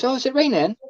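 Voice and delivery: high put-on mum voice; imitated mother. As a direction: mimic mum asking a daft question. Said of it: "and she goes".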